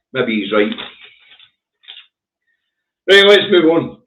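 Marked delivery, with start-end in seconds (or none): 3.1-4.0 loud move on. Real, not acted.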